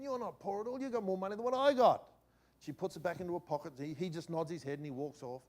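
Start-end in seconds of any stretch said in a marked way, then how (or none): 0.0-2.0 higher, a mock-outraged inner voice; half-amused disbelief at a beggar's cash; indignant thought. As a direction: scoff at her in your head. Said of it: "I'm thinking".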